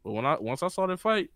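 ordinary talk, nothing marked

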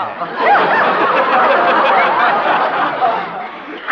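loud airy laugh; mother laughing at her son's mishap; breathy laugh. Acted. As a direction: laugh along with your son.

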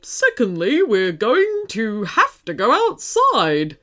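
deliberate put-on teddy voice, slowing down; teddy taking charge. A narrator's reading. Teddy Marshall instructs the children, taking charge. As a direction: list the next step in character.

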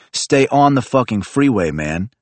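exasperated shout at the driver; angry shout. Acted. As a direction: yell at him to stay on.

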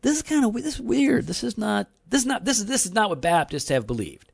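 high, incredulous rising voice; baffled Arminian. Retold or imitated. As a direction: sound thrown by it. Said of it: "even Arminians are like".

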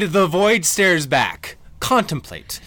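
mock profound poet, slow and grand; pretentious poet. Mock deep line about voids.

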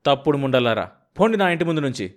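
loud furious shout; husband driving the women from his house, shouting. Acted. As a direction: yell them off the doorstep. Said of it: "మళ్ళీ అరిచాడు".

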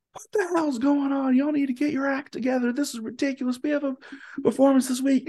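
high, strained furious scolding; angry director rant. Retold or imitated. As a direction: tear into the cast like an enraged director. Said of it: "yelling at us".

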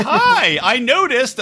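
imitating the old neighbor lady cheerfully. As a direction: pipe it up like the sweet old neighbor. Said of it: "And then her going".

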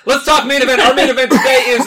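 high, loud hype intro; announcer voice. Acted. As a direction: pump up the main event. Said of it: "announcer hype".

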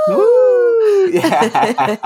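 very high squealed woo; woo girl. Retold or imitated. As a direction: shriek like a drunk party girl. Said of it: "being the woo girl".

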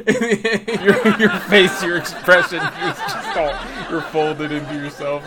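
laughing through the words; giggling while teasing. Real, not acted. Guest laughs about the host's face.

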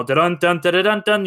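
hummed brass hits dun dun dun; 1 mock bombastic score. Mimicking the loud film music for friends. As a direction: belt out the booming score hits.

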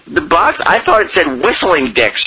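ordinary talk, nothing marked

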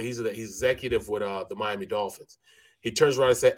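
usual speaking voice

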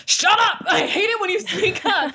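high-pitched play-acted scolding; mock angry outburst. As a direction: lash out in a hammed-up high voice. Acting out scolding one's past self.

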